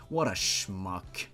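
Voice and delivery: ordinary delivery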